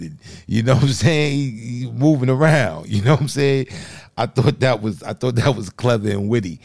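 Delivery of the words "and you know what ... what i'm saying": laughing. Real, not acted. Laughing over a funny poem line.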